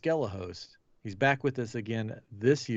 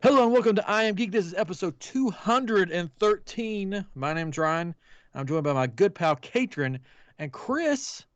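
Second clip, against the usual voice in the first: show host intro; 7 semitones higher than usual. Acted. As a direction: announce the show with energy. Restarted podcast intro read.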